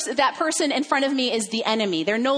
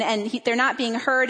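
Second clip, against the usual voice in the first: normal talking voice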